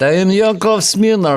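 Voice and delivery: put-on stage Russian accent greeting; a mild Russian accent. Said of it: "you say it".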